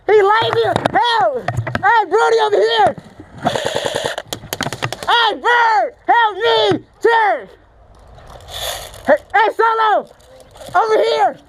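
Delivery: high, urgent calls to teammates; calling for help. Real, not acted. Player getting jumped calls teammates over, calling out. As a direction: holler for backup while under fire.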